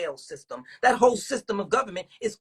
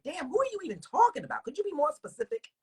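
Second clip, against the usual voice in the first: higher, incredulous comeback; mock incredulous; 10 semitones higher, 3 dB quieter than usual. Retelling how he answers the Afrocentric, incredulous and higher. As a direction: act baffled and pin them down. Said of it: "and I be like".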